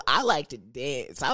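ordinary talk

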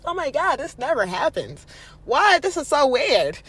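high, loud, over-the-top astonishment; mock disbelief. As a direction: act out someone loudly amazed at their luck. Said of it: "don't be like".

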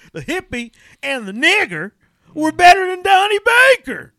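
high squeaky rise on Baker; squeak at phrase ends. Retold or imitated. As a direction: repeat the quote, squeaking up at the end. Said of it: "his voice goes up at the end like that".

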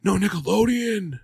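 high-pitched mock outrage; put-on high voice of indignant disbelief; mock freak-out. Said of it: "like, freaking out".

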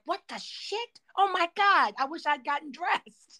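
high, stunned mutter to himself; panicked disbelief behind the closed door; shocked to myself. Retold or imitated. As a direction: caught in his underwear, mortified and high-pitched. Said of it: "I shut the door like".

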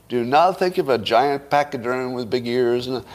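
usual speaking voice

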